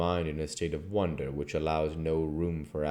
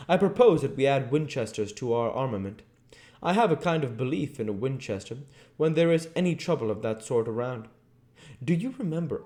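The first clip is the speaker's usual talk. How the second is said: higher voice of a plain-spoken frontiersman; Quincey Morris; 9 semitones higher, 4 dB louder than usual. Acted. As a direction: propose it with easy, confident resolve. Quincey Morris proposes Winchesters.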